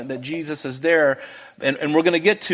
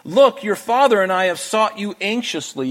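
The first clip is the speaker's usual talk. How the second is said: a hint of a worried mother, higher pitch; mother's anxious question; 7 semitones higher than usual. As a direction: lightly voice Mary's anxious plea. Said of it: "his mother said to him".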